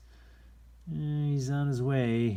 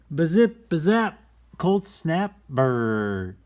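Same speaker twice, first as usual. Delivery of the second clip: higher and louder, bouncy bazip bazap; playful sound words; 7 semitones higher, 9 dB louder, slower than usual. Acted. Reading aloud to kids, punchy playful sound effects. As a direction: belt the silly sounds out.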